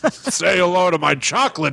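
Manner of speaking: hammed-up movie star impression, laughing through it; Al Pacino. Co-host retelling the film as its star, hammed-up impression. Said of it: "And Al Pacino's like".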